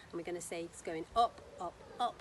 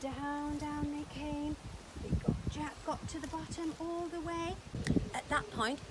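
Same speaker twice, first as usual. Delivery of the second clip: usual speaking voice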